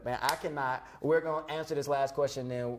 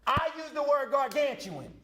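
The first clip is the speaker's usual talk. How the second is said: mock proclamation; 13 semitones higher than usual. Co-host boasts of a big word, mock proclamation. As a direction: proclaim it high, showing off the word.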